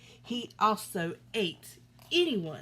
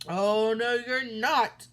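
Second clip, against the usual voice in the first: loud troll roar; 8 dB louder, slower than usual. A narrator's reading. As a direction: roar it down at him. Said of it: "roared the troll".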